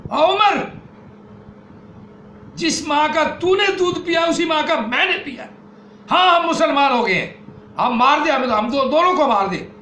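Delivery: high, loud defiant cry; defiant shout. Acted. Sister defying her brother Umar, fierce shout. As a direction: shout defiance in his face.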